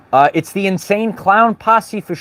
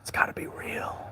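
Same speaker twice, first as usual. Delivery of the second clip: whispered mock belief; 18 dB quieter than usual. Mocking TV believers, whispers it. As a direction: breathe it out as a secret. Mocks gullible viewer.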